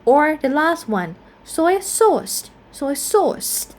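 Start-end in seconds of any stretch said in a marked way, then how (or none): 1.4-3.7 "soy sauce" with the weight on SAUCE; stress on sauce. Shown as a demonstration. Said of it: "stress in the second element here".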